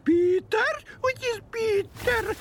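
high, theatrical mystic voice, feigning confusion; fortune teller voice. Acted. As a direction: stay in the clairvoyant act, pitched high. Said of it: "My name is Madame Merlina Sosostris".

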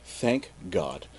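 very soft, low aside; mock relief. Captain as his ship sinks, mock relieved murmur. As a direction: let it out low and hushed.

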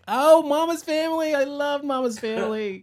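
high, loud delighted squeal; excited. Real, not acted. Thrilled when a friend names a favourite show, excited. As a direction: gush over a beloved show.

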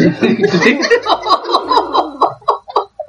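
high, helpless laugh; real amused laughter; burst of laughter. Real, not acted. Laughing at absurd lyric.

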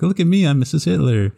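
put-on girly daughter voice. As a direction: ham up a giddy young daughter admiring herself.

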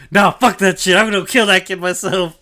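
high, loud furious yell; furious Moria. Acted. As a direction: roar it like a villain who's been insulted. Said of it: "Moria just gets instantly angry".

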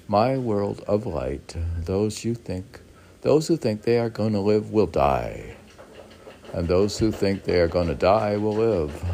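reading a poem aloud to a friend. Acted. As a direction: declaim it like a proverb. Reads out aphoristic poem.

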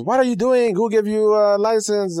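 high, loud indignant yell; angry driver. Retold or imitated. Driver who smashed the car yelling at its owner, angry. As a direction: bark at the other driver. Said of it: "you get out of your car pissed".

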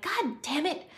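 loud, high furious outburst over the carpet; angry friend. Acted. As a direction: yell at them like they ruined everything. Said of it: "and your friend's like".

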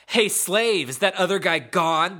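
loud, growling dummy voice; throaty growl. Acted. Dummy asks the girl in the final paragraph, throaty growl. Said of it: "asked in a throaty growl".

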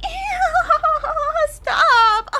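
very high giggling "ew, stop"; mock giggly girlfriend. Vlogger imitates the girlfriend, high squealing giggle.

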